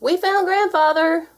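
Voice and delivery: high, loud, slow announcement; announced. A narrator's reading. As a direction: proudly announce the find. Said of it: "Wilbur announced".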